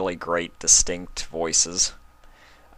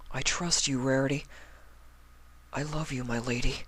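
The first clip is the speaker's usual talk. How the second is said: much softer, drifting farewell; fading Spike; 6 semitones higher, 8 dB quieter than usual. Acted. Spike saying goodbye to Rarity, softly. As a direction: let the words drift off into the void.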